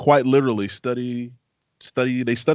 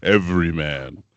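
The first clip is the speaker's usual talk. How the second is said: more bass; 5 semitones lower than usual. Shown as a demonstration. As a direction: lower every man from the diaphragm. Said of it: "Come from the diaphragm".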